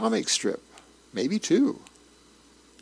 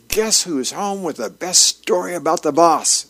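mildly raised, higher announcing call; acted excited call into the house; 5 semitones higher, 4 dB louder than usual. A narrator's reading. Dagwood rushes into the house from work, calling out.

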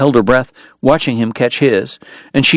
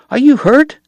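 much higher, urgent worried question stressing "you"; anxious question; 14 semitones higher than usual. Acted. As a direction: check on her in a panic. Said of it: "he cried".

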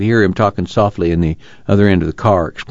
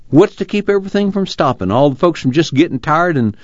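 much higher, plaintive challenge; demanding; 8 semitones higher than usual. A narrator's reading. Uncle doubts folks will keep going, demanding. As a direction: push the doubt upward in pitch. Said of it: "Uncle John demanded".

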